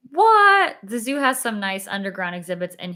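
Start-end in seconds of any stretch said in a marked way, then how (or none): 0.1-0.8 high, loud shocked what. Real, not acted. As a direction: blurt it out in disbelief. Shocked reaction to chat.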